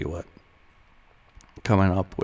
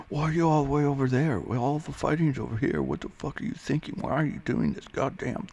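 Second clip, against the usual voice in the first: higher, rushed, mock-angry rant; trash-talking teammate; 7 semitones higher than usual. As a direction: rattle off an imitated gamer's scolding. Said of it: "starts talking shit to me".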